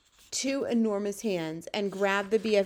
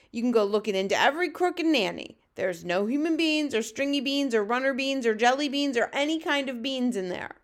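quick start, higher protesting giant voice; BFG protesting; 4 semitones higher, 3 dB louder than usual. Acted. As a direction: protest your innocence in a raised giant voice.

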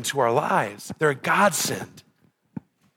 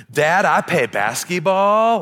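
put-on higher, drawn-out toddler voice rising at the end; two-year-old impression; 6 semitones higher, 4 dB louder, slower than usual. Two-year-old tells dad about daycare, imitated toddler voice. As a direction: imitate a little boy, words swinging upward. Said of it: "do a spot-on impression of my two-year-old".

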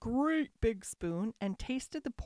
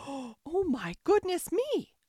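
gasp, then a high, acted cry; startled cry; 7 semitones higher, 5 dB louder, slower than usual. A narrator's reading. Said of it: "cried Goldilocks".

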